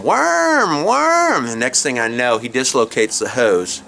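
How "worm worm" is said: high, drawn-out mimicked toddler wail; screaming toddler. Storyteller as little Willie yanking the hose, high mock wail. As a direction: imitate a small boy crying out high. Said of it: "Willie's yanking on that hose and going".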